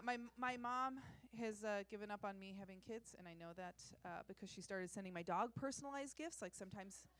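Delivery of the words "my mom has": ordinary talk, nothing marked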